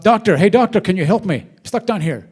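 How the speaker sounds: man stuck in a hole shouting up to a passing doctor; shouting for help. Retold or imitated. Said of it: "he shouts up".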